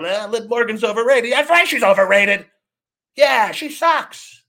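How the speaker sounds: high, loud mocking tough-guy fan. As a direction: ham up a chest-puffing fanboy. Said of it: "feel like big, tough little boys".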